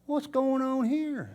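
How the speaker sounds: hushed mock confusion; puzzled. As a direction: wonder aloud under your breath. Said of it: "And you're going".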